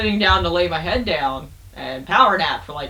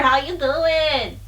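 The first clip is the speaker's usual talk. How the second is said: flirty drawl; 7 semitones higher, much slower than usual. Acted. Flirty greeting to friend.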